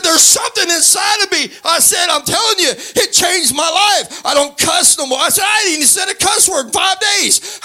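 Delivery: high-pitched, exuberant testimony at full tilt; fervent preaching. Real, not acted. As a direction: preach it high and excited, punching the line ends. Preaching at full force, retelling conversion.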